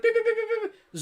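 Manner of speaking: high-pitched rapid computer beeps; computer beeping. Acted. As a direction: mimic a TV crime-lab computer, high beeps. Mimicking CSI computer enhancing an image.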